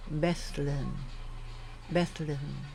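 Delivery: "Bethlehem" said carefully on its own, stress on the first syllable; pronunciation demo. Shown as a demonstration. Trainer shows lectors how to say names, "Bethlehem" enunciated carefully. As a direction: model "Bethlehem" slightly low and careful.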